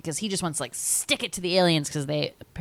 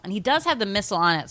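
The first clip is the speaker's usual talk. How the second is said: normal talking voice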